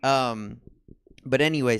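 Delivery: ordinary delivery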